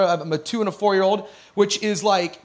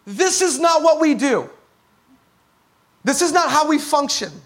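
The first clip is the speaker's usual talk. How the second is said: higher, a father stepping in to stop it; firm father; 6 semitones higher, faster than usual. Retold or imitated. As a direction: call out like a father halting a fight. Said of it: "nobody stopped and said".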